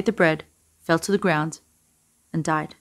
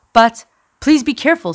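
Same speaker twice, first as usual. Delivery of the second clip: louder, higher plea for caution; urgent warning; 6 semitones higher, 7 dB louder than usual. A narrator's reading. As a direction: urge caution, voice pitched up. Man urgently warns the Rav.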